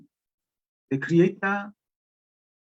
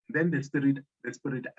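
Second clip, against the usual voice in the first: ordinary delivery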